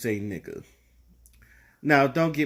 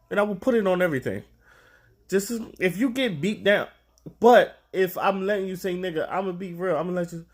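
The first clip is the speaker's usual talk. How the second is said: ordinary talk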